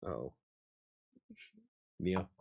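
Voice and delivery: ordinary talk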